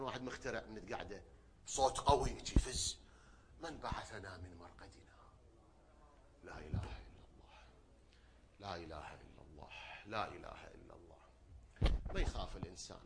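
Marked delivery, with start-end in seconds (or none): 3.6-5.0 hushed, fearful whisper of the waking dead; frightened whisper. Acted. The dead rising from graves, whispering in fear. 6.4-11.2 low, hushed, trembling murmur; fearful murmur. Acted. The waking dead murmuring the creed in fear.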